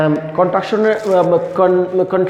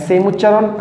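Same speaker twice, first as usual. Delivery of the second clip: ordinary delivery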